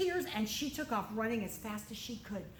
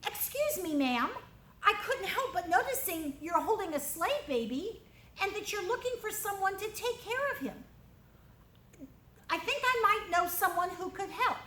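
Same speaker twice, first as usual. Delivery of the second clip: higher, polite little sister voice; bold little girl; 7 semitones higher than usual. Acted. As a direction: speak up boldly to a princess. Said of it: "She walked right up to the princess and said".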